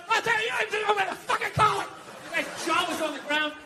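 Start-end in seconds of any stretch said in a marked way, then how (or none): 0.1-1.8 higher, shouted furious dad; mock angry dad. Dad snapping at the priest over the altar boy schedule. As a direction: bark it like a dad telling off a priest. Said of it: "my dad started getting his leg on".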